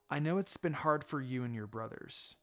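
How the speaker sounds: reassuring. Acted. Mother comforting daughter.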